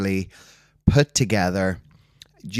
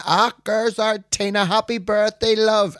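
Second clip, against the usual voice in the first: much higher, sing-song hail across the shop; excited shop greeting; 12 semitones higher than usual. Retold or imitated. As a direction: call it out high for the whole shop to hear. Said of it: "Bridgene was working behind the counter".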